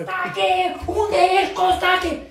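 high, carrying call. Acted. Wife searching for her husband, calling out high. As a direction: call high for someone out of sight.

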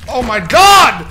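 real outrage at the game; furious shout. Real, not acted. Dies to bug, yells.

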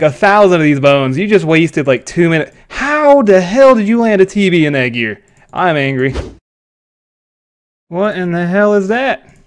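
ordinary delivery

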